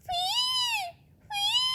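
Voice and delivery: high whistled "phee"; bird whistle imitation. Narrator imitating a bird's whistle.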